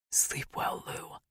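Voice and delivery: soft, hushed goodnight; tender whisper. A narrator's reading. Tender goodnight after the kiss.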